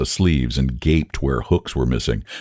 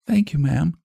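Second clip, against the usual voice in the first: put-on timid girl; 12 semitones higher than usual. A narrator's reading. Girl thanks Sarah for the cake, in a high timid voice. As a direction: thank her shyly, much higher.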